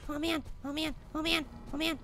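low, very soft dread; nervous muttering. Real, not acted. Player uneasy alone at night, nervous muttering. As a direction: mutter it under the breath.

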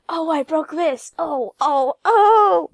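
frantic yelps rising to a high shout; maniac. Shown as a demonstration. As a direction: scream like a maniac breaking bones. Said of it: "I'm gonna sound like a maniac".

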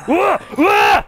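sudden high yelp of fright; startled scream. Real, not acted. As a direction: scream in shock. Jump scare in horror game.

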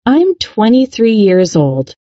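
ordinary delivery

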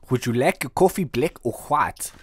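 put-on a light Afrikaans accent offering coffee. Host offers the player coffee in a light Afrikaans accent. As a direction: ask in a mild Afrikaans lilt. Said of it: "That is Afrikaans".

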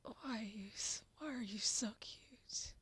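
ordinary talk, nothing marked

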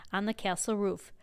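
usual speaking voice

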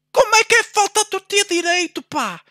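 high, loud indignant shout; furious protest. Real, not acted. Gamer shouting at the referee over fouls, furious protest. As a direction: yell your outrage at the unfair calls.

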